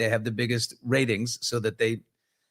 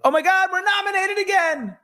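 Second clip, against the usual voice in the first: very high-pitched mock squeal of delight; mock excitement. Host mocking TV staff at awards news, squealed mock excitement. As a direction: gush in a hammed-up, squealing falsetto. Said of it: "they'd be like".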